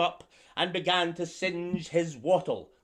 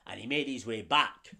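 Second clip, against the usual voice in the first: usual speaking voice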